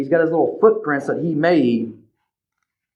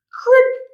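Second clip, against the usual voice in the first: very high sharp crack sound; a snapped twig in the woods; branch snapping. Acted. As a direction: mimic a twig breaking. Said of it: "A little branch breaks behind Ben".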